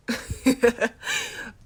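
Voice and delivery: sudden loud, high laugh; burst of laughter. Acted. Boyfriend can't take his sentimental line seriously, bursting out laughing. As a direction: crack up out loud.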